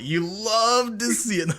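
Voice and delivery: high, drawn-out catchphrase imitation; Cheese Pops. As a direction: mimic a streamer's yelled catchphrase, stretching it out. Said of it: "it's such an easy impersonation of me".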